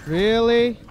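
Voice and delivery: loud, high disbelief; incredulous. Real, not acted. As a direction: exclaim in annoyed disbelief. Tripped up in game.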